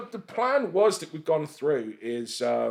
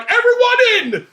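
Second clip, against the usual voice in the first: much higher and louder, slow, herding shout; urgent shout; 10 dB louder than usual. Retold or imitated. Wrestler hears sirens, shouts urgently at the crowd. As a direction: bellow to get the crowd inside now. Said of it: "you can just hear me going".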